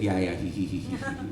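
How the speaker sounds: imitating a sheepish, low giggle. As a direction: mumble a sheepish agreement, tailing into mock tittering. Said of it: "they all sheepishly said".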